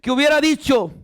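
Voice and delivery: loud, high, fervent sermon delivery; full-force preaching. Acted. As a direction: preach it to the whole church, full voice.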